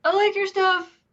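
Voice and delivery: high, loud gushing imitation; mock fan greeting. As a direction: blurt it like an awkward fan. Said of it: "Dan's words will be".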